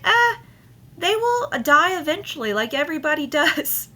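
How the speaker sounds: high, careless mock of the wife shrugging it off; dismissive wife. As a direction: toss it off in a high airy voice. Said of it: "And she's like".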